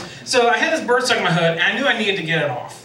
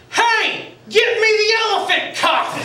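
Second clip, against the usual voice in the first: nurse calling out; 14 semitones higher, slower than usual. Retold or imitated. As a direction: shout for the cuff down the hall, much higher. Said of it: "leans out, and says".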